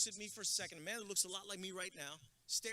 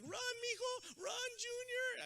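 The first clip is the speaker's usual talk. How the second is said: high, slow, drawn-out parent cheer from the sidelines; much slower than usual. Retold or imitated. Parent at field day cheering their son, high and stretched out. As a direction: cheer your kid on, way up high. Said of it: "all the parents would shine".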